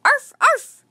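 high, loud yappy barks; little dog barking. A narrator's reading. Teddy barking from the pack, high and loud. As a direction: yap like a small dog. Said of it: "Teddy barked".

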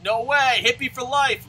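loud, high rallying cry; mock defiance. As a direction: call it out like a proud slogan, retold. Acts out defiant reply to agent.

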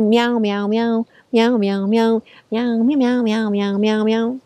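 chanted run of kitten meows; kittens meowing. A narrator's reading. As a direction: meow on and on like a litter of kittens. Reader imitates kittens all meowing.

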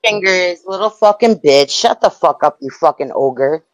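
ordinary delivery

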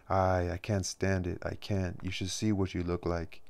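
plain reading voice

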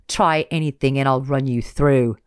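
loud menacing threat; threatening. Acted. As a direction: warn her coldly. Villain threatens with sword.

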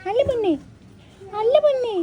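high, falling coos; cooing endearment. Real, not acted. Owner fussing over mother of three kittens, high cooing.

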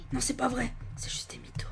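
hushed whisper, barely voiced; whispering while hiding. Real, not acted. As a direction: whisper so the enemy won't hear.

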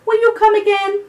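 high, loud hopeful call. A narrator's reading. Children to the departing dugong, calling out. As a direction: call the question after someone leaving. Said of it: "called Benji and Belle".

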